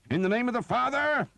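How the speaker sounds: loud, high holy war cry; shouted prayer. A narrator's reading. Priest fighting with a quarterstaff, shouting high and loud. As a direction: hurl the blessing like a blow. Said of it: "he shouted".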